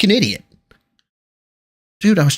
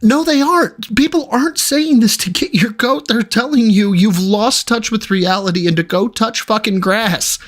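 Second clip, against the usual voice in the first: higher and insistent, pushing back; heated rebuttal; 6 semitones higher than usual. Real, not acted. Co-host firing back at a chat comment, heated and higher. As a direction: set the commenter straight, fired up.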